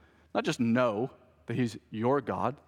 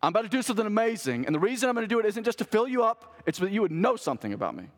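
fast, high, eager announcement; quick, raised voice of God calling to Moses; God crying out; 7 semitones higher, faster than usual. Acted. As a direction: rush it out like a thrilled promise. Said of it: "the voice of God cries out".

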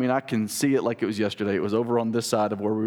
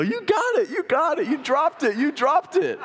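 excited cheering; 14 semitones higher than usual. Retold or imitated. Dad cheering his son's first deer, excited and much higher. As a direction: cheer like a proud dad at the kill.

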